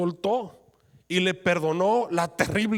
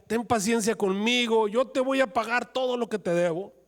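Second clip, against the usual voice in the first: high begging plea; pleading debtor; 7 semitones higher, 3 dB louder than usual. Acted. Fellow servant at his feet, begging high for patience. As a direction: beg him to wait for the money.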